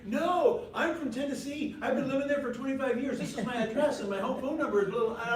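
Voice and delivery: high, loud, eager chatterbox imitation; mock oversharing. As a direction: gush out far too much to a stranger. Imitates oversharing wife answering a stranger.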